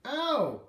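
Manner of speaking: high, loud yelp of pain; pained shriek. Acted. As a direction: shriek as if hit. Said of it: "Lexi shrieked".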